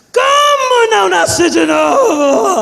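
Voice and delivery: slow, high, loud indignant shout; outraged shout. Retold or imitated. Daughter shouts back at her mother in outrage. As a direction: bellow the disbelief.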